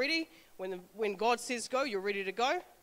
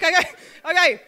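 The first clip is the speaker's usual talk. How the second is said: higher and much louder, laughing between the two; giddy nervous okay, okay; 6 semitones higher, 12 dB louder than usual. Retold or imitated. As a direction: laugh off the nerves. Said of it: "'cause I'm nervous".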